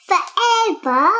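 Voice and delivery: forever called out loud and bright; loud finish. Acted. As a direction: sing out the last word like a storybook ending. Child reads the book's ending.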